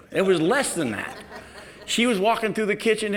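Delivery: usual speaking voice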